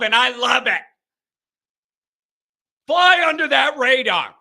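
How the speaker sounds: rally the team like a coach; passionate rant. Real, not acted. Host ranting to fans, fired up. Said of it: "Already ranting".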